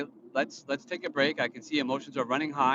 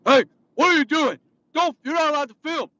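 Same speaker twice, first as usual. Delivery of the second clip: much higher, much louder mock yell at the customer; imitating a furious manager's high shout; retold screaming; 14 semitones higher, 11 dB louder than usual. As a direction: bark it out like a bully manager storming over. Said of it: "basically screaming at the top of his lungs".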